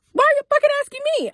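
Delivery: very high, fast indignant retort; mock-outraged squeal in a retold argument; exasperated outburst. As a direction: fire it back in a high, incredulous rush. Retold quarrel with girlfriend.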